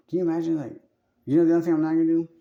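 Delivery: excited outburst. Real, not acted. Excited outburst, loud and fast.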